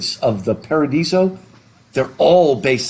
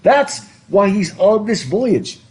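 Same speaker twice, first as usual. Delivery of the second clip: normal talking voice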